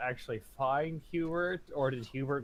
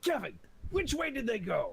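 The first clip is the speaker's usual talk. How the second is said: high character voice; 11 semitones higher than usual. Acted. Player voices character addressing Kevin.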